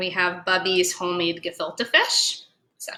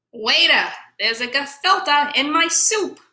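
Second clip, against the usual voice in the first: higher, indignant voice; complaining diner; 6 semitones higher than usual. Acted. Diner complaining to the waiter about soup. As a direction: summon the waiter.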